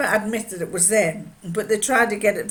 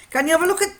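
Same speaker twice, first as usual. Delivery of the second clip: higher, louder attempt at Geordie; Geordie accent; 10 semitones higher, 8 dB louder, much faster than usual. Miner asking the watchmaker for help, Geordie accent. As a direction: try out a Geordie lilt. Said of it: "he'd say it in Geordie".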